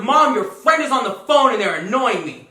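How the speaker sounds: loud, drawn-out mock complaint; calling out to mom. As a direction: holler for mom like an annoyed kid. Acts son calling out to mom.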